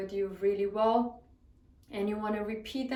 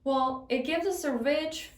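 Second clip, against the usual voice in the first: ordinary delivery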